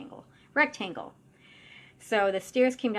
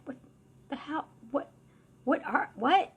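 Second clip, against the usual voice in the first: halting, high-pitched disbelief; baffled; 7 semitones higher than usual. Retold or imitated. Medium recounting first sight of the creature, baffled. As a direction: stammer it out in rising confusion.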